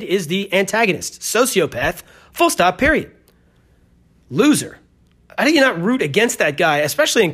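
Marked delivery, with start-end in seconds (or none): none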